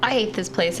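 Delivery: ordinary talk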